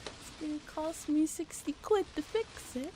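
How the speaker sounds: hammed-up London accent demanding money; exaggerated British accent, indignant. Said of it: "Okay, so they are British".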